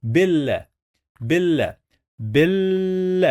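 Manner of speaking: BIL with the l held long before a short a; doubled l. Shown as a demonstration. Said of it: "we pronounce the letter two times".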